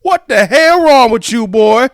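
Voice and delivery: outraged teacher yell, high and drawn out; loud, slow, comic scolding; Steve Harvey. Acted. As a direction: holler at a misbehaving pupil in mock outrage. Harvey scolding a student in a bit.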